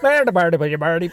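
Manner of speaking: mock Northern Irish accent; hammed-up Northern Irish lilt. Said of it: "It's just your accent I was doing".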